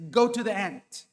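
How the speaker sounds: stern rebuke to the sluggard. Shown as a demonstration. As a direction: order the lazy son.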